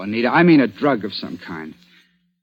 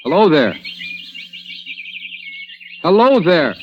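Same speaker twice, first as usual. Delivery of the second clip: calling out; 8 semitones higher than usual. Acted. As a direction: call out to someone hiding. Calling out to hidden man.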